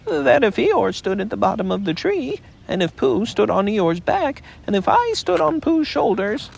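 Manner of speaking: high, small earnest voice; timid little pig, very earnest; earnest Piglet. A narrator's reading. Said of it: "said Piglet earnestly".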